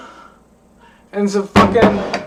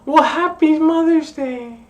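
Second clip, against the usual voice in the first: higher, sweet mock cashier greeting; imitating cashier lady; 8 semitones higher than usual. Cashier lady wishing a customer happy Mother's Day, mock sweet. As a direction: lightly chirp it like a friendly shop clerk. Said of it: "And she's like".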